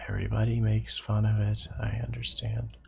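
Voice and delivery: a soft self-pitying dragon mumble; mumbling under his breath. A narrator's reading. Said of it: "Kinda mumbling under his breath".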